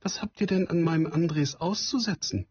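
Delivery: a mother a little hurt for her son; Frau Stine. Acted. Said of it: "mit fast zürnenden Augen". (leicht gespielte Frau, etwas empört)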